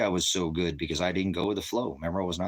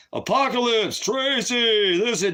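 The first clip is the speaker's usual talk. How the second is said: very high, loud, drawn-out proclamation; mock voice of God; 7 dB louder, slower than usual. Man parodies God calling to him, booming proclamation. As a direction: call it out like a voice from the heavens. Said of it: "when he speaks to me".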